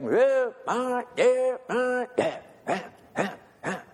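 high chanted marching cadence; drill cadence call. Retold or imitated. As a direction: chant the count like a drill corporal. Corporal calling marching cadence.

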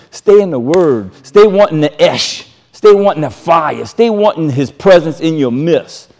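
normal talking voice

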